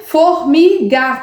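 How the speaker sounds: put-on Formiga stretched slowly, syllable by syllable as for a child; slow syllable-by-syllable sounding out. Shown as a demonstration. Teacher sounding out Formiga for pupils, slowly syllable by syllable. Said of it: "não fez o som do mi".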